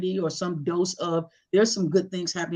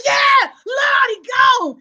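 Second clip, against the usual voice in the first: high, loud, drawn-out cry, imitated; mock grief-stricken grandma; grandmother wailing; 9 dB louder, much slower than usual. Grandmother confirming the death, hammed-up wail. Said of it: "but do the voices".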